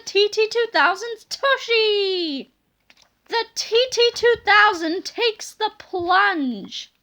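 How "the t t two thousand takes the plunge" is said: usual speaking voice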